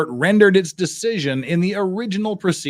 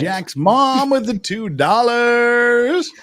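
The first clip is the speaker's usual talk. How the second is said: high, loud, long-stretched catchphrase yell; drawn-out holler; 8 semitones higher, 6 dB louder, much slower than usual. Pretend. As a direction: holler it and stretch the last word out. Shouts a running-gag catchphrase.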